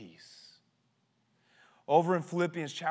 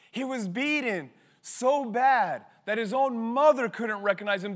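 ordinary delivery

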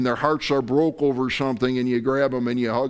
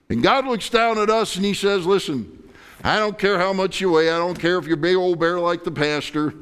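preaching; 8 semitones higher, 5 dB louder than usual. Acted. Preaching at full force.

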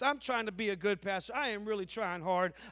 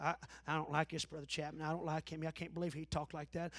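lower, softer grumbling; grumbling churchgoer; 7 semitones lower, 10 dB quieter than usual. Retold or imitated. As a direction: mutter a complaint behind his back. Church member grumbling about the pastor.

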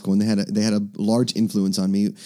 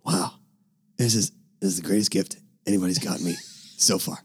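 put-on lower, softer and quick old man; a teacher thanking a student for a gift, imitated lower and softer; imitating the old teacher; 4 semitones lower, 6 dB quieter than usual. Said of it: "He was this funny old man".

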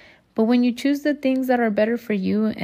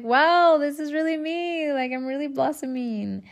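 higher, awed and proud; amazed delight; 7 semitones higher than usual. Retold or imitated. Admiring her own first makeup look, higher awed delight. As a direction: gasp at your own reflection, wowed.

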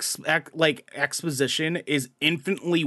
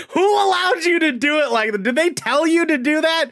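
higher, louder disbelieving outburst; incredulous rant; 11 semitones higher, 4 dB louder than usual. Real, not acted. Ranting at Woody Harrelson over his line, high and loud. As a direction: demand an answer in amused outrage.

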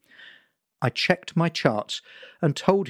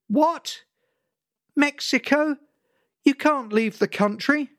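alarmed mother; 12 semitones higher than usual. Acted. Mother hearing her son is leaving for Mexico, shocked. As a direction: explode with disbelief down the phone. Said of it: "The phone blew up".

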